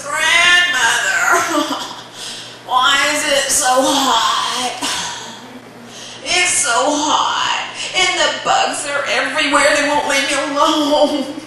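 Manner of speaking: slow, drawn-out whine; whiny complaining child. Acted. Boy whines to grandmother about heat.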